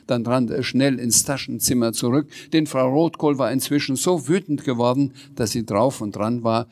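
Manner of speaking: ordinary delivery, nothing marked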